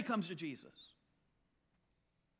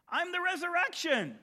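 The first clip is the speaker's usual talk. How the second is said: high, loud proclamation; ringing declaration, higher and louder; proclaiming Jesus; 11 semitones higher, 6 dB louder than usual. Retold or imitated. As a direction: announce it boldly as Jesus.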